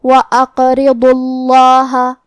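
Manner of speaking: level chant of وَأَقْرِضُوا اللَّهَ, thin ri, full ḍu; qalqalah, heavy Allah. Shown as a demonstration. Teacher demonstrating heavy lam in Allah, slow tajweed recitation. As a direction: slowly recite وَأَقْرِضُوا اللَّهَ with bounced qaf and thick, long Allah. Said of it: "موٹی آواز میں پڑھیں گے".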